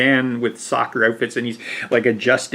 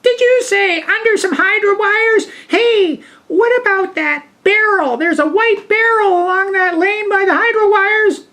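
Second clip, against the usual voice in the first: much higher, louder eager wife voice; excited wife; 5 dB louder than usual. Retold or imitated. Wife calling from the front door to strangers, high and loud. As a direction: pipe up high and loud, helpful and keen.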